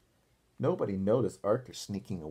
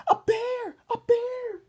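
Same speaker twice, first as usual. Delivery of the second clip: much higher, louder hammed-up alarm cry; mock holler; 9 dB louder, slower than usual. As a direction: fake a panicked bear alarm, barely hiding a giggle. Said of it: "hollered Muffy and Buster and Francine, trying not to giggle".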